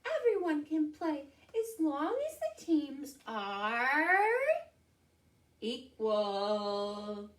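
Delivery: high mouse, slowing on the last words; high, drawn-out little mouse. Acted. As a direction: pipe up high and stretch the ending. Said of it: "Mouse said".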